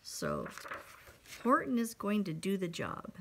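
ordinary delivery, nothing marked

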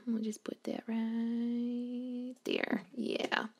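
soft whispered aside, slowed right down; slow hushed whisper to nobody. Real, not acted. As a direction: breathe it out slowly under your breath. Whispering while placing item.

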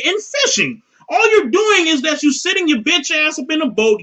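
usual speaking voice

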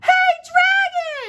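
very high, loud yell; shout. Acted. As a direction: yell as loud as you can. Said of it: "shouted as loud as she could".

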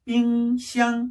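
"삥샹" slow, two level held notes; double first tone. Shown as a demonstration. Teacher drilling fridge for students, "삥샹" slow level tones. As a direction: stretch "삥" and "샹" without moving pitch. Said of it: "한 번 더".

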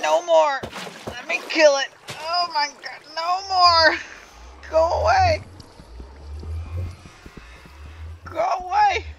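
high, loud frantic shouting; panicked yelling. Real, not acted. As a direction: yell it at the monster. Yelling at attacking mob.

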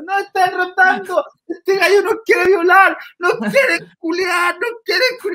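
very high shrill hammed-up yelling; mock scream. Drunk passenger screaming about the driver, very high. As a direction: shriek it, tipsy and theatrical. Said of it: "empieza a gritar".

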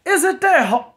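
high, loud stunned disbelief; can't believe what he's hearing; shocked disbelief. Retold or imitated. Narrator shocked at the boyfriend's answer, high and loud. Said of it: "I was like".

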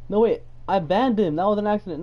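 a real oh-no as it sinks in; alarmed realisation. Real, not acted. Streamer finds he banned a viewer by accident, alarmed.

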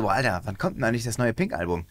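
low, hushed wondering; mock musing. Imagined fan wondering about a new album, quiet low musing. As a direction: voice a fan's thought under the breath. Imagined fan's thought, voiced.

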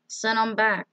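usual speaking voice

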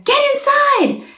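high loud call of alarm; holler. A narrator's reading. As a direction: yell it up to the townsfolk. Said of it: "the knights would holler".

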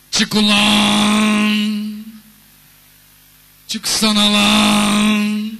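very slow, drawn-out loud yell; truck yelling. Pretend. Truck shouting at the Volkswagen, long loud yell. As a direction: bellow it like a runaway truck. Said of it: "Volkswagen'e bağırıyor şimdi".

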